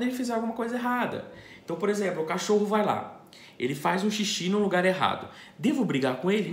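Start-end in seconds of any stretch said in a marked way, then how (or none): none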